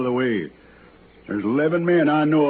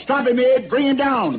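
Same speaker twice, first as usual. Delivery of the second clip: shouted order; 10 semitones higher than usual. Acted. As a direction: yell the command across the clearing. Sheriff orders deputy to shoot.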